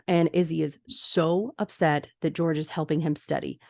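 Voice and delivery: normal talking voice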